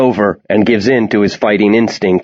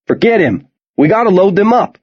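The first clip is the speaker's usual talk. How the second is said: shouting; 10 semitones higher than usual. Acted. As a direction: yell it over the noise. Soldier shouting to another soldier.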